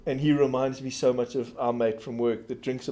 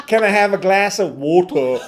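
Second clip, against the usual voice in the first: mock American accent, higher, louder and slow; mixed American accent; 5 semitones higher, 7 dB louder than usual. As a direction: imitate her slipping American accent. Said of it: "she had this American accent".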